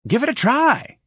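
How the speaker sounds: very high, cheery character voice; bright encouragement. A narrator's reading. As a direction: chirp it encouragingly. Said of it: "said brightly".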